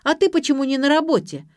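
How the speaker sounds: high, loud counter-question; sharp retort. A narrator's reading. Daughter turns father's question back on him, retorting. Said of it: "отбила мяч дочь".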